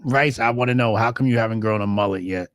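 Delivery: normal talking voice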